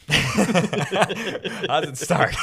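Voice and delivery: slow and loud through laughter; laughing question. Real, not acted. Forgetting how the story starts, laughing. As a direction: laugh it out, slowly. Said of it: "I forgot".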